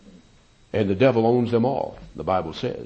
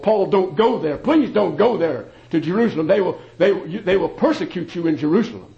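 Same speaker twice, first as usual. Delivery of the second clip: higher, louder begging voice of the worried friends; pleading friends; 8 semitones higher, 5 dB louder than usual. Retold or imitated. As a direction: plead with Paul to stay away. Friends begging Paul not to go.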